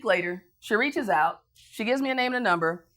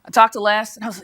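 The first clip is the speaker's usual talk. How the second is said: usual speaking voice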